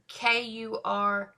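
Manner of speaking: slow letter-by-letter K U R O K O; each letter spoken apart, unhurried; spelling out letters. Shown as a demonstration. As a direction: spell the name out slowly. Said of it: "it is spelled".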